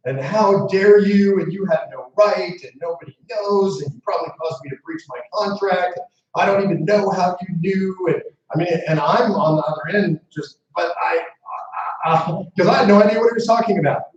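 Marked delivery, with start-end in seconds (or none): 0.2-8.1 retold angry tirade. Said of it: "he's screaming at me".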